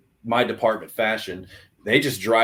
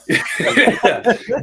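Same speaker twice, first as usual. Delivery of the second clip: high, loud burst of laughter; 13 semitones higher, 6 dB louder than usual. Real, not acted. Laughing at cohost's camera joke.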